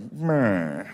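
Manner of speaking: drawn-out falling announcer drawl; old-time announcer. Acted. Announcers seeing Jackie come to the plate, drawn-out drawl. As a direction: stretch the word like a vintage radio man. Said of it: "You can hear the announcers like".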